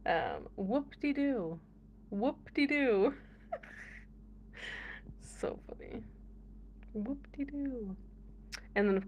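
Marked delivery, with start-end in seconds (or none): 0.6-3.1 slow, lightly voiced old grandpa; grandpa's whoop-de-doo. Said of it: "And then my grandpa". 6.9-8.0 slow, lightly voiced old grandpa; grandpa's whoop-de-doo. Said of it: "And then my grandpa".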